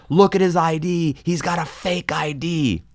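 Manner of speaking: mock screaming. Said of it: "Wachovia is going screaming".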